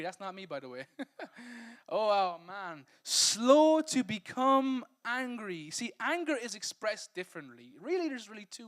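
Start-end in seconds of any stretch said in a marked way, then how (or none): 3.0-5.7 drawn-out emphasis. Shown as a demonstration. Preacher repeating verse phrase.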